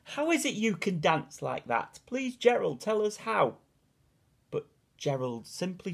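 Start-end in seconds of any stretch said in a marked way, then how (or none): 0.0-3.6 high, eager begging; eager pleading. A narrator's reading. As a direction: plead excitedly. Animals begging Gerald.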